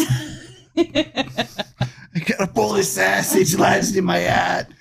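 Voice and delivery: high hammed-up sketch voice; SNL sketch character. Quoting an old SNL skit to friends, hammed-up sketch voice. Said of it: "don't know that reference".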